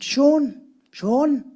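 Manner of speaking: loud call across the room. Retold or imitated. Producer calling Sean loudly across the studio. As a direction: shout the name to get his attention.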